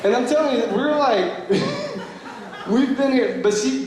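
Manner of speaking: words high through laughter; laughing. Real, not acted. Telling friends about the girl, laughing through words. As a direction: laugh while you say it.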